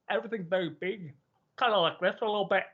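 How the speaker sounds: imitating speech shaped round an apple; big round vowels. Coworker showing how a Scottish accent is done, big round vowels. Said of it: "Imagine you're eating around an apple".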